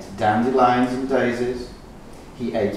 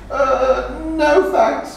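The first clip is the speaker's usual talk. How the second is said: much higher, loud sing-song shout; scared goat shouting no; 14 semitones higher, 6 dB louder, much slower than usual. Acted. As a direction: shout your refusal in fright. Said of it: "he shouted".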